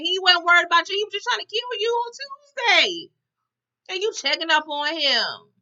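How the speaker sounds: climbing high, loud incredulous rant; indignant disbelief. Acted. Viewer calling out Leon's hospital visit, indignant disbelief. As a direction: pitch soaring in comic outrage.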